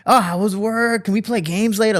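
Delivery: very high, gushing mock sweetness; hammed-up, high-pitched adoring kid; over lovey-dovey. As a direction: lay the affection on way too thick. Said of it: "I'm, like, over lovey-dovey".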